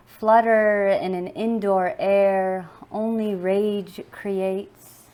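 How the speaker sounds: measured, emphatic spoken-word reading; poem recitation. Acted. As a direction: recite with weight and pauses between phrases. Performs own poem.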